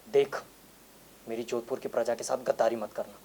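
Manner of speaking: low, hushed warning from a maharaja; quiet threat. Retold or imitated. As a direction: say it softly, like a gun-to-the-head warning. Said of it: "बंदूक रख के कहा था". (दबी आवाज़ में धमकी)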